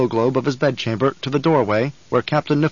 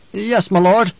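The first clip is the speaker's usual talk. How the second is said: nervous guard captain; 7 semitones higher, 5 dB louder, slower than usual. Acted.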